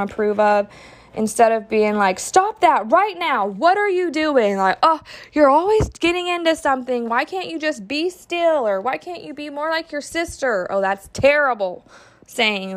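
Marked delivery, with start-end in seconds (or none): 2.2-4.6 high, sharp parental scold. Retold or imitated. Mom scolding her kid for misbehaving, sharp and high. 4.8-8.7 high, fast, exasperated nagging; nagging scold. Retold or imitated. Mom nagging her restless kid, high and exasperated. 8.8-10.7 high, reproachful parental jab; cutting comparison. Retold or imitated. Mom comparing her child to a sister, high and reproachful.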